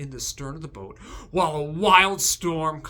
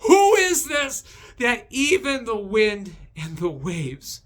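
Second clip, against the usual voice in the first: awed fear; 7 semitones higher, 8 dB louder than usual. Retold or imitated. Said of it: "to fearing Jesus".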